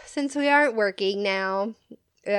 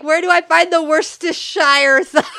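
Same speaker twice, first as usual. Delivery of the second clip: high, loud, laughing imitation with mangled Worcestershire; mock clueless question; 6 semitones higher, 7 dB louder than usual. Friend mimics the caller lost in the store, high and laughing. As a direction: mimic a lost shopper stumbling on the word. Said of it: "And she was like".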